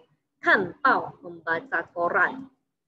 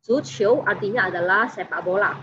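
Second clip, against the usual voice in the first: usual speaking voice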